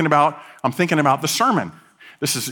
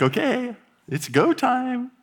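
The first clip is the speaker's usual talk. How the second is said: acted readiness; 9 semitones higher, 3 dB quieter, slower than usual. Imagined wedding day, time to start.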